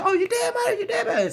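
very high, shrieked threat; retold screaming. Woman chasing her man to the car, retold screaming. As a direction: imitate her screeching after him. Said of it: "she screaming and yelling".

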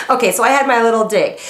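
ordinary delivery

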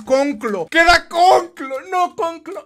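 slow, loud, drawn-out groan of a name; mock dread. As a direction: ham up the horror of spotting the rival. Dreads the last rival.

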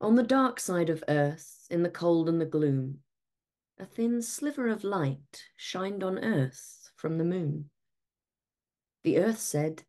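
plain reading voice

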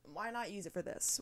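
quiet, thrown-away musing; half to herself. Real, not acted. To listeners about the cheap mic, quiet musing. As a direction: mutter the idea to yourself first. Asks herself, then repeats louder.

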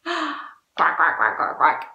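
low, rapid run of quacks; duck quacking. Pretend. Teacher hinting who's at the door, quacking duck. Duck noise before the reveal.

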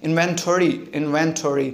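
inventory said as a single word, IN-ven-to-ry; inventory unbroken, stress on IN; one-word pronunciation. Shown as a demonstration. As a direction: model inventory clearly, no split. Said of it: "only a one word".

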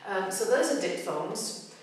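normal talking voice

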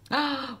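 sudden surprised gasp; sharp gasp. Real, not acted. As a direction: gasp at the twist. Reacts to plot twist.

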